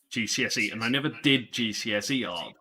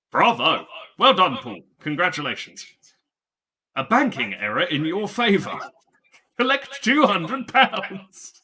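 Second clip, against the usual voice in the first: loud, higher mock fanfare of praise; mock congratulations; 6 semitones higher, 6 dB louder than usual. Teasing his friend about the woodwork grade, loud mock cheer. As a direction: boom it out like a board-game card announcing a win.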